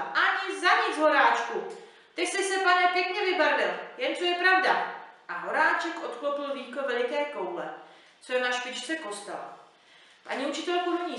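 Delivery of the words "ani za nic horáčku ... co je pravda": loud, high defiant cry; teacher shouting. A narrator's reading. Teacher on his palm shouts up at the giant, loud and high. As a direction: scream your refusal up at him. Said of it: "paní učitelka křičela".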